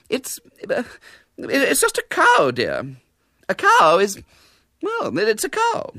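high, halting, flustered lady; stumbling over an obvious answer; flustered spinster. A narrator's reading. Miss Milton explaining grass, high and flustered.

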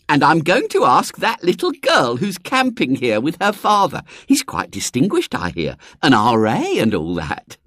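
society hostess chatting; gossipy lady. A narrator's reading. Mrs Bott voiced in story.

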